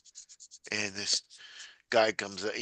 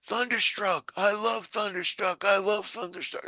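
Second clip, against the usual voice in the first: high, gushing fan voice; excited fan voice; 9 semitones higher than usual. Retold or imitated. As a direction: gush about the song up high. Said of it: "he's like".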